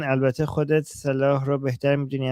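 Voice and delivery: ordinary delivery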